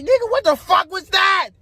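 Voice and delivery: high-pitched, incredulous yell; retold outraged shout. Man retelling his reaction to her boyfriend confession, outraged shout. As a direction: blurt it out in exasperated disbelief.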